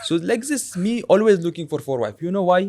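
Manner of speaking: put-on broken-English father character accent; lightly voiced old father with a heavy accent; Abu Zubair accent. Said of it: "He has an accent".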